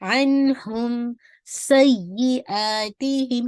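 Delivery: slow, drawn-out tajweed chant; Quran recitation. Shown as a demonstration. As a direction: recite it slowly with full stretch. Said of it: "time देके complete करें sound को".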